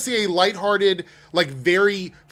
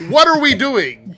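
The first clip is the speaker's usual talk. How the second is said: incredulous; 6 semitones higher, 8 dB louder than usual. Retold or imitated. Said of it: "And I'm like".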